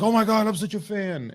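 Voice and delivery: high-pitched gushing fanboy; mock excited squeal of adoration; imitating fan freak-out. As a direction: mimic a starstruck fan losing it. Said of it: "freaked the fuck out".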